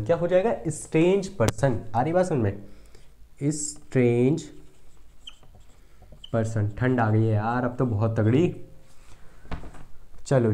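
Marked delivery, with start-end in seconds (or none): 3.4-6.7 halting, writing-along pace with a long gap; slow dictation. Shown as a demonstration. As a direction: dictate strange person word by word. Teacher dictating while writing.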